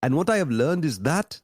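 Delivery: put-on a clumsy try at a Ugandan accent; a bad, mocking imitation of a Ugandan student's accent; terrible Ugandan accent. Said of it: "that's a terrible Ugandan accent".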